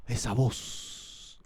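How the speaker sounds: very slow, breath-only whisper; drawn-out hushed wonder; awed whisper. Acted. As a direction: whisper it in stunned recognition. Acts out Mary recognising the voice.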